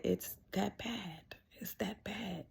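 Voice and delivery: doctor whispers the grim verdict, slowly; grave whisper. Retold or imitated. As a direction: breathe it out like bad news. Doctor confirms grim news.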